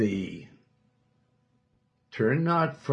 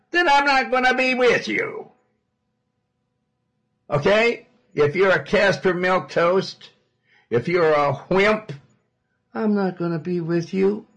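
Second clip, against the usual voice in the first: loud, high booming warning; stern shout; 9 semitones higher, 7 dB louder than usual. Acted. Preacher booming God's warning at weak believers, shouting. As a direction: thunder it at the congregation as God.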